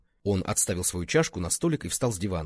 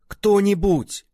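desperate shout, much higher and louder; отчаянный крик; 11 semitones higher, 9 dB louder, much slower than usual. A narrator's reading. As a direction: call out into the empty ruins. Desperate call amid ruins. (отчаянный громкий крик)

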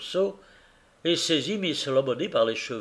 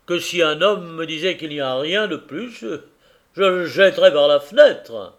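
rising into a loud threat; honest man bristling, slightly higher; a touch of indignant carrier; 3 semitones higher, 7 dB louder than usual. A narrator's reading. Carrier warning Tackleton he would throw the man out, louder at the end.

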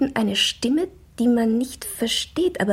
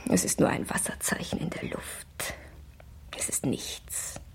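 barely audible breathy whisper, softer and lower; 7 semitones lower, 11 dB quieter than usual. Acted. Alone with her thoughts, dismissing it in a faint whisper. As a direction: let it fade into a whisper. Inner monologue sinking away.

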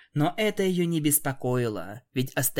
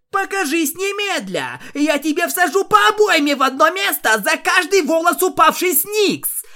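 much higher, much louder enraged yell; furious shout; 10 dB louder than usual. Acted. Rainbow Dash yells at Gladys in fury, furious shout. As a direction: scream threats at the hidden enemy. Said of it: "Рейнбоу Дэш была вне себя от ярости".

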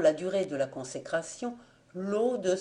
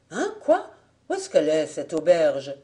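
« Hein, quoi ? » lancé beaucoup plus haut et fort; étonnement vif; 5 dB louder than usual. Acted. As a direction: sursaut de surprise, voix qui grimpe. Joseph s'étonne de l'auberge. (surpris, voix montée très haut)

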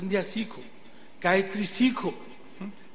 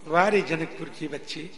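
usual speaking voice

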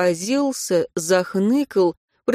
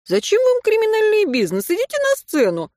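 sarcastic; 14 semitones higher than usual. Acted. Sarcastic advice to Katya.

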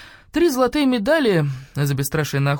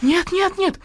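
high-pitched frantic pleading; squealed, terrified repetition; panicked denial; slower than usual. A narrator's reading. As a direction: refuse in rising panic. Terrified woman hearing wolves sing.

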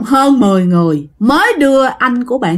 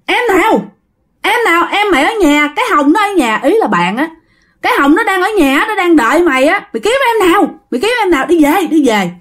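much higher, exasperated retort; arguing back; 7 semitones higher, faster than usual. Retold or imitated. Cousins arguing the brother home, higher exasperated retort. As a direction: throw his words back at him, pitched up. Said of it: "mới nói rằng á là".

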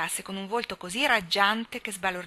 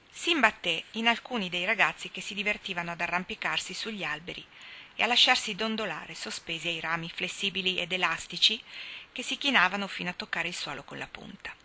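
ordinary delivery

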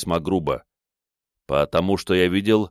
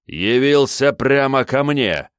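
loud, high, slow outrage; indignant shout; 7 semitones higher, 5 dB louder, slower than usual. Acted. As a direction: shout it at the intruder. Villain shouting from darkness.

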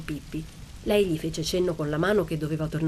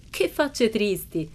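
a touch higher, slow and playful; Pippi's teasing voice; 4 semitones higher, much slower than usual. A narrator's reading. Pippi teasing the attic children, lightly voiced. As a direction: tease the sad faces gently.

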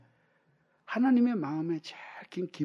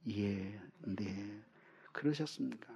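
overly polite churchgoer; 9 semitones lower, 13 dB quieter, slower than usual. Retold or imitated. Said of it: "아주 예의 바르게 대해야지".

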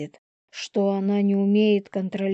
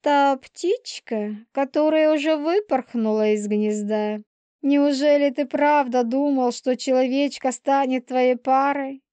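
higher-pitched, goading Polina figure; spiteful she-wolf; 6 semitones higher than usual. Acted. Полина mocks Елисей about his human girl, spiteful. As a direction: provoke him, pitched well up.

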